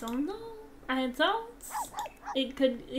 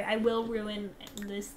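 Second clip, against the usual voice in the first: ordinary talk, nothing marked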